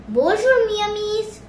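high, lilting hello to friends; sing-song greeting. Shown as a demonstration. Quiz answer for greeting a friend, sing-song.

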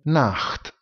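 Nacht con CH aspirato e marcato; Nacht, CH raschiato forte in gola; CH enfatizzato. Shown as a demonstration. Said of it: "io la enfatizzo di molto". (Nacht con CH gutturale esagerato)

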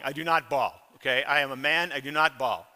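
ordinary delivery

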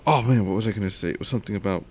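usual speaking voice

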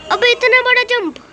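high-pitched exclamation of dismay; real astonished outburst; startled disbelief. Real, not acted. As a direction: exclaim it high, as if faced with the impossible. Sees a very big jump.

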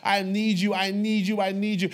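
intense pleading prayer. Retold or imitated. Preacher relives crying out for God's presence, intense pleading. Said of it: "I remember the intensity inside of me".

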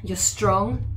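normal talking voice

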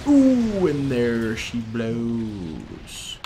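slow, sinking cry of awe; drawn-out exclamation. Real, not acted. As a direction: stretch it out like a lookout's long falling call. Cheering a tank blowing up.

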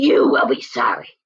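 low wolf growl. Acted. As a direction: threaten in a deep growl. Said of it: "growled the wo".

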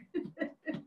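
a quiet unvoiced little laugh; soft breathy chuckle. Real, not acted. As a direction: let a laugh fade out. Trailing laugh.

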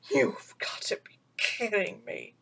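whispered disbelief, low; disbelieving whisper. Acted. Bagheera refusing Mowgli, whispered disbelief. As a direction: breathe it out in disbelief. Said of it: "Bagheera said".

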